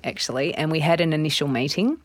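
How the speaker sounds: ordinary talk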